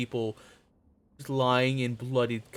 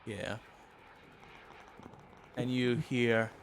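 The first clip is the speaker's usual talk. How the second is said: ordinary talk, nothing marked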